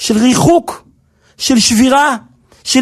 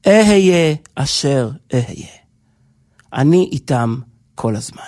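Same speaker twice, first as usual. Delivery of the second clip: much lower, slow, hushed divine voice; lowered reverent voice of God promising presence; 10 semitones lower, slower than usual. Acted. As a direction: speak as God, low and deliberate. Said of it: "אומר לו הקדוש ברוך הוא".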